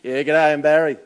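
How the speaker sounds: loud laughing Aussie g'day; laconic outback drawl. Shown as a demonstration. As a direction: boom out a laughing bloke greeting. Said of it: "Am I Barry?".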